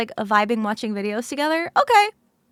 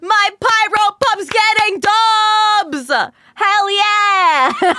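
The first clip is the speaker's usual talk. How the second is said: loud, high, slow shout of joy; excited cheering; 7 semitones higher, 6 dB louder, much slower than usual. Real, not acted. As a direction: cheer the viewer on at full volume. Cheering chat's date news.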